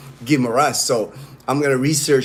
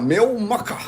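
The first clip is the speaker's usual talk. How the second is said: higher-pitched mock British accent; 7 semitones higher than usual. Showing how his British friend talks, imitated British accent. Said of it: "he talks like that".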